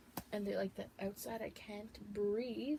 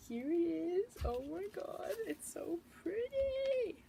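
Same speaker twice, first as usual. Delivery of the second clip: higher, drawn-out delighted squeal; excited gushing; 9 semitones higher, much slower than usual. Real, not acted. Vlogger opening her new palette, high excited squeal. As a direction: gush over it, slow and high.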